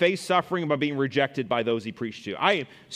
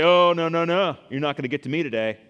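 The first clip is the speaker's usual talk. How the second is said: loud dismissive "oh no no"; mock defiant listener; 2 semitones higher, 6 dB louder than usual. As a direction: brush the preacher off, loudly. Mimicking scowling hearers.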